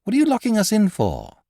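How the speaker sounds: astonished outburst; greatly surprised. A narrator's reading. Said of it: "greatly surprised".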